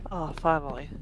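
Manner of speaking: usual speaking voice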